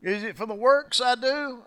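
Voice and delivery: high, mock-puzzled sarcastic questions; sarcastic Jesus. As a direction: needle them with fake innocence. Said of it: "I love the sarcasm of Jesus".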